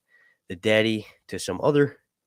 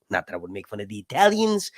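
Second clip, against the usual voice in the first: usual speaking voice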